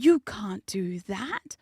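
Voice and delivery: slow, breathy mock outrage; mock scandalised objection. Someone objecting to the hammer plan, mock scandalised. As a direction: draw it out aghast, rising at the end. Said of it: "someone's like".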